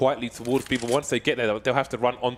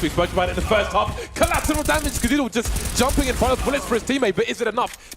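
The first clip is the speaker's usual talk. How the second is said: excited call; 9 semitones higher than usual. Real, not acted. Caster hyping a collateral kill, excited. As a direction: ride the collateral moment up.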